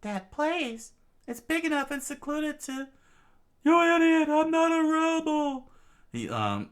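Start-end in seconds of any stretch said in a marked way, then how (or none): 0.0-2.9 very high falsetto; high-voiced realisation; princess thinking aloud. Princess works out the hiding place. 3.6-5.7 very high, loud falsetto protest; shrieked falsetto objection; indignant yell. Acted. Usopp yells at pursuing guard.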